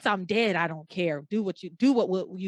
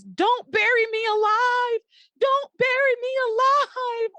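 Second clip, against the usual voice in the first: high, loud, drawn-out imitated scream; retelling the trapped woman's silent scream, loud and high; mock yell; 5 dB louder than usual. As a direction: yell it like she's trapped in the coffin, hammed up. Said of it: "yelling in her consciousness".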